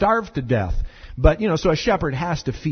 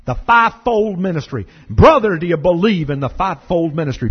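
louder, hammed-up Southern preacher drawl; 5 dB louder than usual. Shown as a demonstration. Pastor mocking a Southern preacher for his congregation, loud Southern drawl. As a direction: lean into a booming Southern revival drawl. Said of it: "if you do it with a southern drawl".

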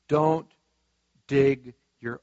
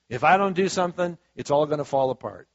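ordinary talk

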